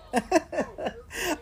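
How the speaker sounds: short tipsy giggle; a quick amused laugh. Real, not acted. Laughs at own remark.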